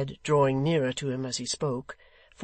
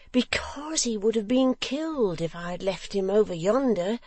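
high, excitable simple son; Barnaby, eager simpleton; 7 semitones higher than usual. A narrator's reading. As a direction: protest and boast in a high childlike voice.

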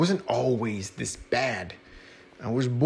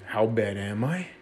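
ordinary delivery, nothing marked

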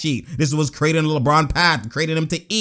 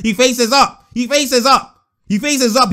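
higher, emphatic repetition; worked-up insistence; 6 semitones higher, 3 dB louder, slower than usual. Real, not acted. Commentator repeating how the player faces up, worked-up insistence. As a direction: hammer the point, pitched up. Repeating his point excitedly.

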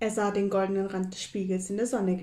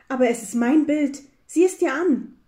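higher, louder, eager insistence; excited insistence; 5 semitones higher, 8 dB louder than usual. A narrator's reading. Hare urges squirrel to look at picture, excited insistence. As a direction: urge them eagerly to look.

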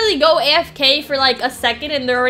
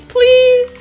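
Please pitched high and louder, drawn out; louder pleading please; 9 semitones higher, 4 dB louder than usual. Real, not acted. Gamer begs the game for solo rounds, high and pleading. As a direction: beg like it's urgent. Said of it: "Solo rounds now".

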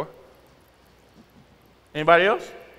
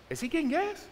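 high, softer puzzled question; incredulous aside; 11 semitones higher, 7 dB quieter than usual. Retold or imitated. Driver waiting behind a man at the pump, high incredulous aside.

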